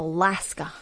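Alaska with a short flat a, American way; Alaska, American style; American pronunciation. Shown as a demonstration. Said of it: "That's how they say it".

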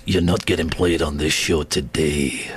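low, put-on Scottish accent. Host refusing the Commonwealth Games trail, mock Scottish accent. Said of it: "I'm doing a Scottish accent".

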